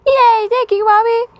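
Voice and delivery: put-on high, loud, gleeful little-kid squeal; mocking the kid. Reactor mimics the oblivious smiling boy, high and giddy. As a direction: squeak it out like a delighted child. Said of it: "And he's like".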